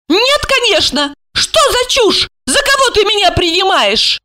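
high, cross woman's retort; indignant high character voice; angry. A narrator's reading. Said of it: "Александра рассердилась".

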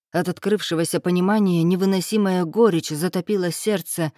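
ordinary delivery

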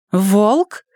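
high, disbelieving question; can't believe it's him; incredulous. A narrator's reading. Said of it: "недоверчиво спросила Эмили".

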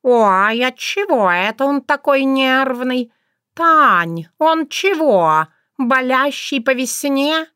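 high, teasing tomcat; mocking cat. A narrator's reading. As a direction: tease him with fake concern. Cat mocks the bristling mouse.

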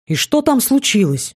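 high, eager question; impatient curiosity. A narrator's reading. Elf interrupting the briefing, eagerly curious. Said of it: "снова не выдержал эльф".

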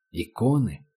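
ordinary delivery, nothing marked

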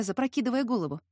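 ordinary delivery, nothing marked

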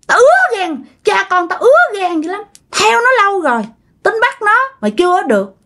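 high, bitter spiteful voice; jealous ghost. Retold or imitated. Ghost of the father envying the happy couple, spiteful and high. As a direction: spit out the envy. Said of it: "Ganh tị nha các bạn, nói rằng á".

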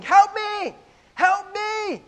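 high, loud desperate cry for help; kid yelling for help. Acted. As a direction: scream it like a child stuck in a well.

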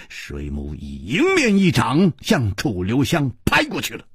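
usual speaking voice

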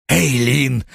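drawn-out growl of the name; hoarse growl. A narrator's reading. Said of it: "хрипло прорычал он".

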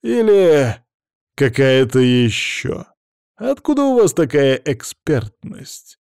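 slow, drawn-out sarcastic superiority; patronizing, leisurely challenge; condescending. A narrator's reading. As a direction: stretch the words out, talking down. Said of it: "поинтересовался Влад снисходительно".

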